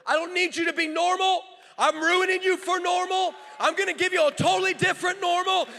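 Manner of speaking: God shouting his answer to the pastor. Retold or imitated. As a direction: declare it like God shouting back. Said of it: "And he shouted".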